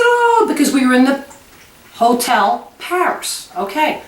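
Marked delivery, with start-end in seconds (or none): 0.0-0.5 a high, squealed oh of delight; mock gasp of delight, pitched way up. Sees the little chef doll, squeals oh. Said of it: "I said".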